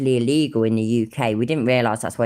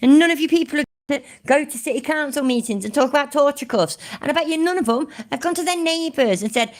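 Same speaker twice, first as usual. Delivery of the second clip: high-pitched exasperated rant; raging; 14 semitones higher, 3 dB louder than usual. Real, not acted. YouTuber scolding followers who skip council meetings, raging. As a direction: rant at them, pitch shooting right up. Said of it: "I try not to rage too much".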